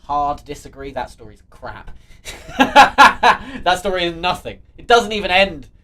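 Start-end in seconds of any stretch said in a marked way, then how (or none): 2.6-5.7 loud, laughing disbelief; exasperated and laughing; laughing outburst. Real, not acted. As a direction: burst out through laughter, much louder. Laughing rant about a bad story.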